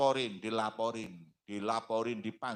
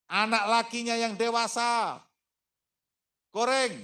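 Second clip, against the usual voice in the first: ordinary delivery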